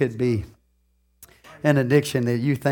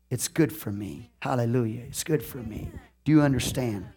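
humble confession; 6 semitones lower, 10 dB quieter than usual. Retold or imitated. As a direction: quietly own the mistake, pleading. Preacher retells his humble confession.

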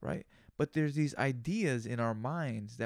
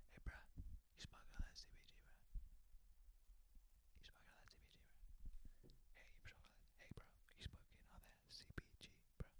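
hushed conspiratorial whisper, almost no voice; 25 dB quieter than usual. Pretend. Asking a buddy about the C B G, whispered. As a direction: lean in and breathe it like a secret.

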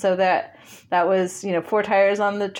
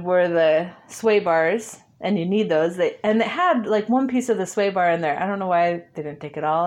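normal talking voice

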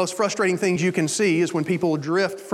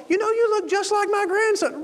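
very high doting grandparent impression; mock sweet old-timer, delighted; delighted elderly patient; 13 semitones higher than usual. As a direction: coo in a high voice at a visitor. Voices the same elderly patient.

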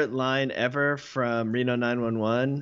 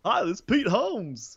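much higher, goofy cornball voice; Pete Holmes impression; 10 semitones higher than usual. Friends mocking a cornball podcast host, high and goofy. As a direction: ham up a cheesy host intro. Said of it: "He is such a freaking cornball".

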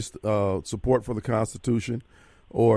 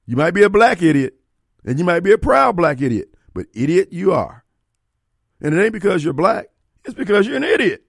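higher, louder heated put-down; heated insult; 6 semitones higher, 6 dB louder than usual. Real, not acted. Host scolding his own listeners, raised and heated. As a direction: lean into calling listeners idiots. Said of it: "you shouldn't say it like that".

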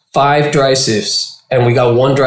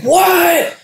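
high, shouted disbelief; stunned what. Retold or imitated. Cuban hears his hash won, shouting in disbelief. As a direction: yelp it out in shock. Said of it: "I was like".

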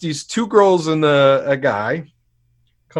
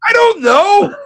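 resposta em pânico; 6 dB louder than usual. Retold or imitated. Retold panicked reply.

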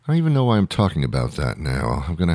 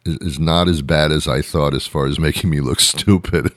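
ordinary talk, nothing marked

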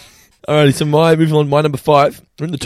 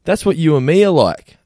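put-on higher, louder and slower kid line; imitating little sister; 5 semitones higher, 5 dB louder than usual. Remembering his little sister's words, higher and slower. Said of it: "she goes".

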